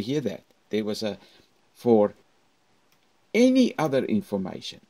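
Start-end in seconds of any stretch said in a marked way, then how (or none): 1.7-4.8 louder, rising "For any other information"; voice goes up. Shown as a demonstration. Teacher showing students to raise their voice. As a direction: lift the voice up, up, up. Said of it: "Up, up, up".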